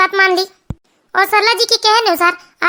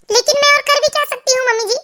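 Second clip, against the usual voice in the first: high-pitched helpless question; helpless daughter-in-law; 6 semitones higher than usual. Acted. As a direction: ask in despair, pitched high. Daughter-in-law despairs to mother-in-law. (लाचार बहू, ऊँचे सुर में)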